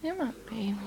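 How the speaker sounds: soft tender whisper. Real, not acted. Owner whispering fondly to her dog. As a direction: breathe it to the dog lovingly.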